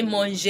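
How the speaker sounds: "manger" as mon-zhay; two syllables in "manger", last one held; wrong sounded final E. Shown as a demonstration. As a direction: voice the mistaken ay ending of "manger". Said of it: "Do not try to say manger".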